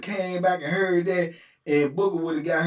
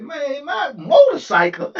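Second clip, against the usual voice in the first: loud, high, slow stretched threat; slow menacing boast; 10 semitones higher, 8 dB louder, slower than usual. Retold or imitated. Booker threatening the lieutenant, slow and loud. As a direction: lean hard on my motorcycle.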